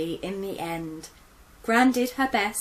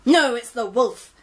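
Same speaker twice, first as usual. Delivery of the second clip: loud cry; 4 semitones higher, 10 dB louder than usual. Acted. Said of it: "his brother cried".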